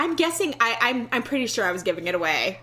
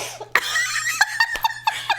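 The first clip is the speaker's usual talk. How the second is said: high shrieking laughter; shrieking laughter fit. Real, not acted.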